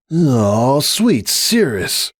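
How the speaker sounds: grumbling. Acted. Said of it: "Hoops grumbled".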